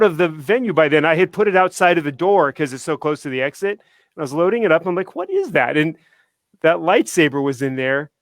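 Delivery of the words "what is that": sharply higher, puzzled question; retelling the moment of finding it; surprised what-is-that. Retold or imitated.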